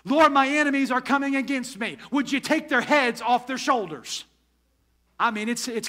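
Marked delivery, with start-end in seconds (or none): 0.0-4.2 raised, louder voice, indignant pleading with God; David's angry complaint. Retold or imitated. Pastor voicing David's angry psalm to the congregation, indignant and loud. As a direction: let the frustration push up in pitch and volume. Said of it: "he was angry".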